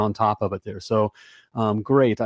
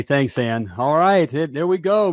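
normal talking voice

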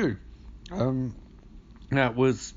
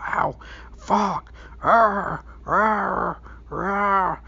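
yelling in pain, pitched high; cartoonish agony yells; mock burning screams; 6 semitones higher, slower than usual. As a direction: ham up a man on fire screaming. Michael on fire, screaming.

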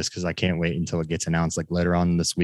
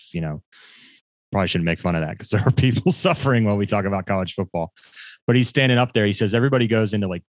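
normal talking voice

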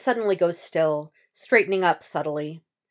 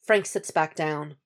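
usual speaking voice

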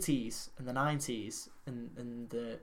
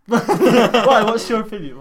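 high, loud and laughing; laughing question; 6 semitones higher, 14 dB louder than usual. Real, not acted. Asking the co-host's opinion, laughing loudly. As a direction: ask through laughter.

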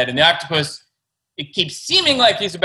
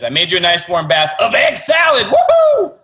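louder, higher prankster whoop at the end; excited octopus; 7 semitones higher, 5 dB louder than usual. Acted. Octopus springs egg-salad bath on boy, loud gleeful whoop. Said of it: "And the octopus says".

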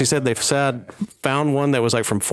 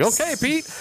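high mock voice; 12 semitones higher than usual. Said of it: "He's like".

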